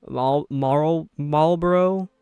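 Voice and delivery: halting false starts at "Marlboro"; unsure, effortful try at "Marlboro"; struggling pronunciation. Real, not acted. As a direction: trip over "Marlboro", restarting on the first syllable before getting it out. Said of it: "one of the words I can't pronounce".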